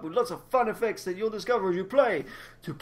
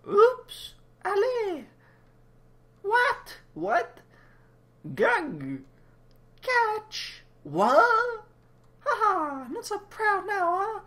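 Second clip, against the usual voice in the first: squeaky taunting voice; 13 semitones higher, much slower than usual. Acted. High squeaky taunting character.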